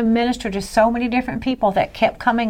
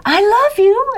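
put-on high-pitched little boy calling out; little louder; 13 semitones higher, 4 dB louder, slower than usual. Little boy calls to woman down the aisle, a little louder. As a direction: call it out high, a notch louder. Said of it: "a little louder".

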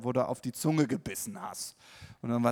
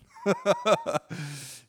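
loud bright burst of laughter; hearty laugh; 7 semitones higher, 6 dB louder than usual. Real, not acted. Preacher laughing at his own reply to God, loud laughter. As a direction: laugh out at yourself.

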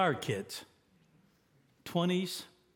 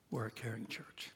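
soft refrain; 3 semitones lower, 10 dB quieter than usual. Real, not acted. Repeats refrain softly.

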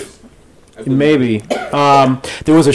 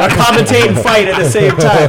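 wrestling commentator; 6 semitones higher than usual. Pretend. Still in commentator bit.

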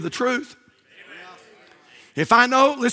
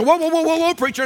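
objecting listener; 5 semitones higher than usual. Retold or imitated. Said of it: "You say".

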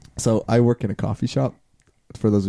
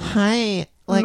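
high, irritating customer voice; put-on high girl voice; annoying girl voice; 8 semitones higher than usual. Impersonating the coffee shop customer, annoying high voice. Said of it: "the voice down".

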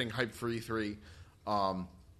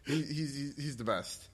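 normal talking voice

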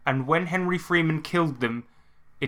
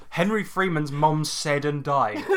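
normal talking voice

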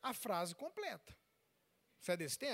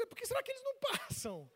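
imitated puzzled wife; 12 semitones higher, faster than usual. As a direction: wonder aloud, pitch climbing. Imitates puzzled wife with siren behind.

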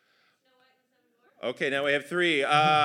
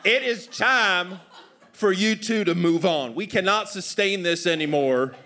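higher, declaring Southern dad voice; stern Southern father; 6 semitones higher, 6 dB louder than usual. Acted. Father sends children away from home, stern Southern pronouncement. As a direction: lay down the law in a Southern accent.

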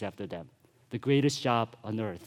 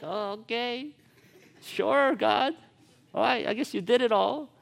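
a lightly voiced doubtful Gideon shrugging at God; reluctant Gideon; 8 semitones higher than usual. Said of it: "Gideon must be thinking like".

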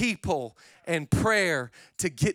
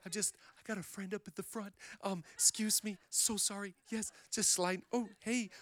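hushed crowd apologies; 15 dB quieter than usual. Retold or imitated. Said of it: "You're like".

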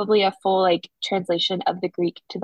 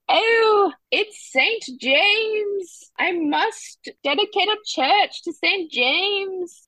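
high, slow, drawn-out mock Bible character; high-pitched, over-awed storybook man; man talking in the Bible; 10 semitones higher, much slower than usual. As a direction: exclaim like a hammed-up Bible figure, stretching the words. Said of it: "anytime there's a man talking in the Bible, it's, "Oh".

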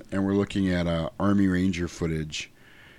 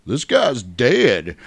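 bartender; 5 semitones higher, 6 dB louder, much slower than usual. Retold or imitated. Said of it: "and he's like".

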